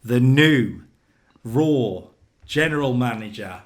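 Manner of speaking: booming announcer build-up; ring announcer. Pretend. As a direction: announce him to the arena.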